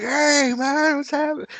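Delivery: high, loud mock greeting yelled across the bar; imitated shout. Retelling how Chris greeted them at the hotel bar, shouting. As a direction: bellow it like a drunk mate spotting friends. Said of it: "Shouting and everything like that".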